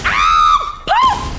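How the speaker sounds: sudden high loud yelp; startled scream. Real, not acted. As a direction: cut off by a scream of fright. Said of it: "I just did a lot of screaming".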